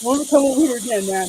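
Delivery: a hint of the ranting streamer, hammed up; mocking echo. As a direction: parrot his angry ban to mock him. Mocking Wog's ban line.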